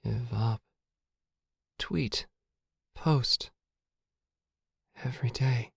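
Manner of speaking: soft, high, disjointed words; faint murmured fragments; mumble. A narrator's reading. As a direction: mumble single words with gaps between. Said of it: "then a mumble".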